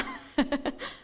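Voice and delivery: light laugh mid-story; amused laugh. Real, not acted. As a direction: chuckle at your own boldness. Laughs at her own big requests.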